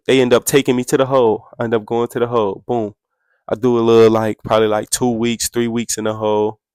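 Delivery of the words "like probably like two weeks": ordinary talk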